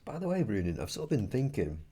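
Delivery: ordinary talk, nothing marked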